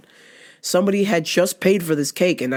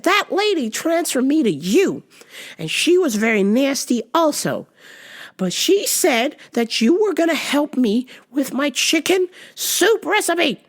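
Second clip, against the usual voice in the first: put-on much higher, fussy older lady on the phone; confused lady caller; 8 semitones higher than usual. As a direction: play the lost caller, pitch way up. Voicing the caller.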